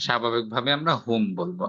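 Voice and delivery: usual speaking voice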